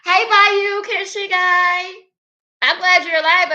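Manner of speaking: high, loud, thrilled hello; excited greeting. Real, not acted. Streamer greeting a returning viewer in chat, loud and excited. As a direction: shout a joyful welcome, stretching the name out slowly.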